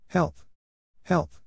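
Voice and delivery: high, short mock cries for help; mock scream. A narrator's reading.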